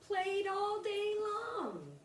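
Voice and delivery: high, slow stretched line; drawn-out finish. A narrator's reading. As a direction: stretch it out happily.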